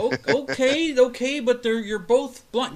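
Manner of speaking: high bewildered mock voice; baffled outsider. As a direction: stammer it out like a puzzled onlooker. Confused outsider questioning groups.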